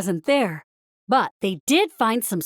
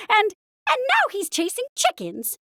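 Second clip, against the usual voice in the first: higher voice for Minnie; 11 semitones higher than usual. A narrator's reading. Minnie tells Mickey what Figaro did, flustered. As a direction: play Minnie, flustered and upset. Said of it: "Minnie finished".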